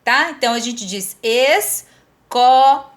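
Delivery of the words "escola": "escola" broken into syllables, loud stretched "co"; teacher's slow demo of "escola", "co" strongest; syllable-by-syllable stress. Shown as a demonstration. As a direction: sound out "escola" slowly, punching the middle "co". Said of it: "é a sílaba mais forte".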